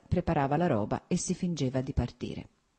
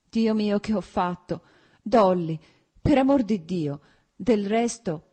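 raised, higher plea near a sob; choked pleading; 6 semitones higher, 5 dB louder than usual. A narrator's reading. Husband implores his wife Dolly, close to sobbing. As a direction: plead urgently, on the verge of sobbing. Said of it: "un singhiozzo gli si era fermato in gola".

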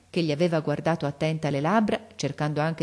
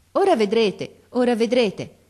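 higher, louder promise; quick-stepping, keen encouragement; eager reassurance; 6 semitones higher, 6 dB louder than usual. A narrator's reading. Narrator hurries the impatient father along, eagerly reassuring. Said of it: "gli risposi affrettando il passo".

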